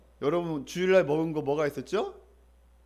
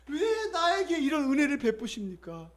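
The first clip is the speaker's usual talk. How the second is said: tearful plea; 6 semitones higher, 4 dB quieter than usual. Retold or imitated. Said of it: "울면서 아마 얘기한 것 같아요".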